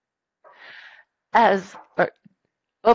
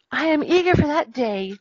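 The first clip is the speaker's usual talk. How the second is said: eager character voice, pitched higher; Little Mermaid; 7 semitones higher than usual. Acted. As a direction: read the quote as a keen storybook heroine. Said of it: "sighed the Little Mermaid".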